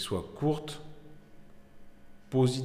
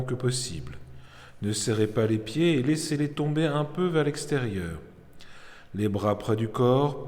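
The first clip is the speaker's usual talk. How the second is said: measured yoga nidra instruction voice; calm, deliberate guided-relaxation delivery; guided meditation; 3 semitones higher than usual. Acted. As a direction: lead them into relaxation, phrase by phrase with long pauses.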